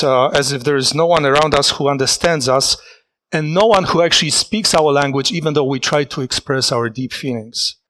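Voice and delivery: normal talking voice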